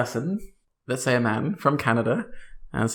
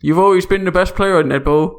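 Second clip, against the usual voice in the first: mock Canadian accent, a bit higher and louder; hammed-up Canadian friend greeting; 7 semitones higher, 6 dB louder than usual. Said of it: "This is perfect Canadian accents".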